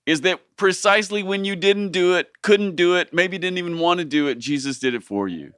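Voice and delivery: preaching the gospel to a friend, fervent and high. Real, not acted. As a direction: preach it with building conviction. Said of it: "I'm preaching".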